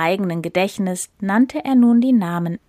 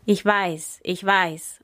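impatient; slower than usual. Acted. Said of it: "unterbrach ihn der Löwe ungeduldig".

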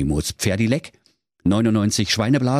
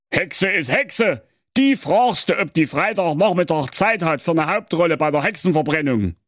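high, blustering, self-important king; pompous king voice; 9 semitones higher than usual. A narrator's reading. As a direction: give orders like a spoiled king. King gives orders.

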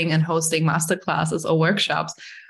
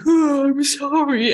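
mock apology; 8 semitones higher, slower than usual. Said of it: "then I'm like".

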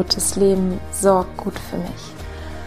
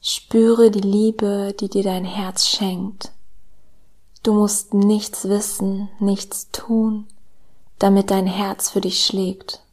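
slow, soft meditation voice with whispered lines; calm guided meditation, hushed and slow; soft spa whisper; 3 dB quieter, slower than usual. Acted.

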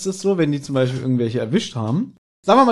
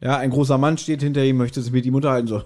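ordinary talk

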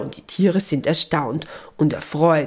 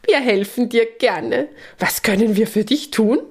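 higher-pitched eager reply; delighted; 6 semitones higher than usual. A narrator's reading. Peppi offering the church mouse help, delighted and higher. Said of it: "erwidert Peppi entzückt".

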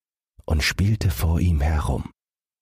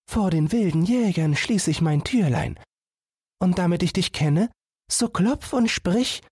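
put-on much higher, gentle warning girl's voice; high girl's voice, anxious for her fawn; caring little sister.

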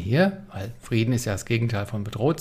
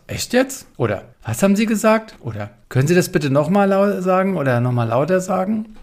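much higher-pitched innocent query; raised, questioning voice playing oblivious; feigned not hearing; 7 semitones higher than usual. Shown as a demonstration. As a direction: ask as if you simply hadn't caught it. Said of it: "unbeteiligt nachfragend".

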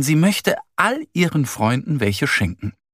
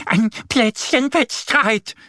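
much higher, slow duck voice; duck explaining; 11 semitones higher, slower than usual. Acted. Annie explaining the quarrel to Bärenmama, high duck voice. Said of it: "erklärt Annie".

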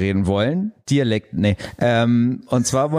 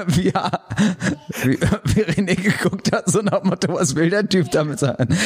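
laughing; 6 semitones higher than usual. Real, not acted.

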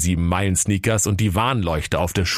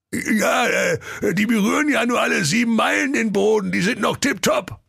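goofy ogre, pitched up; proud ogre. Acted. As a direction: insist the soles are fine. Ogre answers the helpline.

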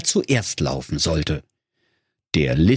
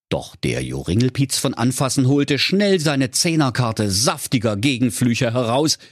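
ordinary delivery

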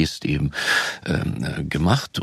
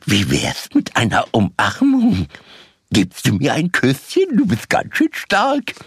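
high, slow Tigger doll voice; squeaky cartoon tiger toy; talking doll; 8 semitones higher, 3 dB louder than usual. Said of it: "das ist die sprechende Puppe".

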